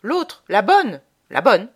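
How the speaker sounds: loud bellow of pain; a peasant with a broken leg yelling. A narrator's reading. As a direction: howl it out in pain. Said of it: "se mit à vociférer".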